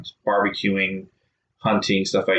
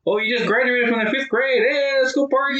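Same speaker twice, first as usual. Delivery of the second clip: much higher, hammed-up excited relative; mock party relatives. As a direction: cheer like a party-crazy aunt. Mocking relatives' party excuses.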